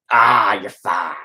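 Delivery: slow, loud, mocking dismissal; a loud, lazy you're fine that waves the problem away; dismissive brush-off. Quoting dismissive friend.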